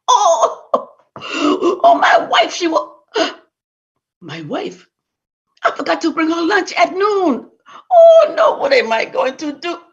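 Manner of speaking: very high, whiny panic; husband dreading his wife's return, high and wailing; panicked wailing. Acted. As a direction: wail in dread of his wife. Husband panicking over wife.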